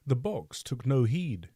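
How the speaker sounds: plain reading voice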